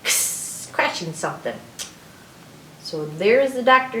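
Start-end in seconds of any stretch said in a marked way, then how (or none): 0.0-0.7 "X" as an unvoiced "ks"; scratchy "ks" for "X", hissed out; letter X sound. Shown as a demonstration. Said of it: "kinda like you're going".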